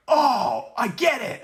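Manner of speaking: high, sudden "oh, I get it"; mock realization. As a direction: sound like it just clicked. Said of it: "who'd be like".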